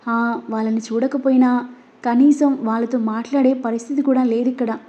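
plain reading voice

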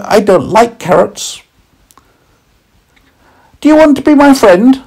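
put-on high, loud tiny boy voice; tiny boy meets a talking carrot, curious and high. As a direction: squeak it out like a small child. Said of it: "said the little boy".

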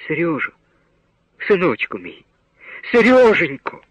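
rising to a high wail on the name; sobbing cry. A narrator's reading. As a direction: sob his name, breaking into a cry. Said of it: "Ридаючи, кричала мати". (мати ридаючи кличе сина)

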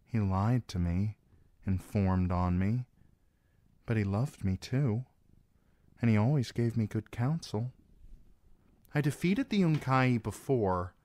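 usual speaking voice

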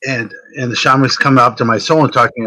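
ordinary talk, nothing marked

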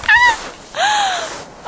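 very high sudden yelp; a startled squeal; high startled shriek. Real, not acted. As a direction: shriek as if slipping. Slipping while running in snow.

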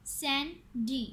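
"Sandy" split into two beats; "Sandy" with a gap between syllables. Shown as a demonstration. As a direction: say "Sandy" as सैन, pause, डी. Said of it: "टू syllable words".

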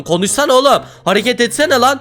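high, impatient goading; angry taunt. Acted. Boss taunting a player who won't respond, angry goading. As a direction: goad him into reacting.

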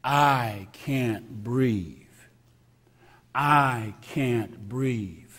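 very slow, heavy repetitions, steadily insistent; slow chant of the dying man's words; slow repeated plea. Retold or imitated. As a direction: toll each plea like a bell. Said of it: "said eleven times".

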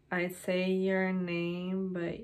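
slow, even, drawn out; word by word, softening toward the end; dictating while typing. Real, not acted. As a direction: read it slowly as your fingers catch up. Typing the next line aloud.

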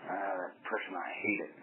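very soft, low grumble; low muttered hate. Retold or imitated. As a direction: grumble it under your breath. Voicing an inner grudge.

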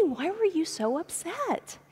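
put-on father kneels to ask his upset child gently; gentle father. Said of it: "Tripp got down and".

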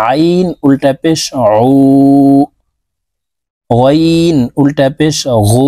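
আইন with throat pressure, উ drawn long; pressed from mid-throat. Shown as a demonstration. Teacher showing viewers the throaty ain, slowly. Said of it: "হলকের মধ্যখান থেকে চাপ দিয়ে".

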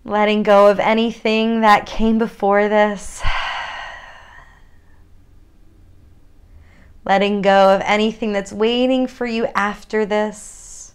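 unhurried guided yoga cues with long pauses; calm grounding exercise. Acted. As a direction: lead them gently into their body.

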